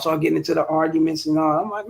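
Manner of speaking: ordinary talk, nothing marked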